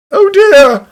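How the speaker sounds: sudden high, loud gasp of dismay; shocked cry. Acted. Detective realizing his foolish mistake, high shocked cry. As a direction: blurt it out, caught off guard.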